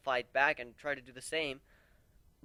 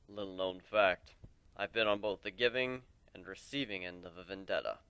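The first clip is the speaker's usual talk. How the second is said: put-on a lower, weary old warrior voice; lightly lowered voice of a weary mentor; lower voiced warrior; 6 semitones lower than usual. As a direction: speak as the old lone warrior, regretful. Old warrior Aoi speaking.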